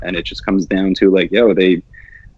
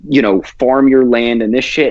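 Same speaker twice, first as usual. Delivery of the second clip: ordinary talk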